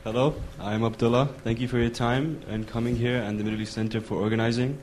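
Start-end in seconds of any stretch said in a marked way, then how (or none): none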